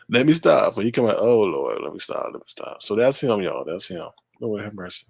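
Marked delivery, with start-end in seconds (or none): none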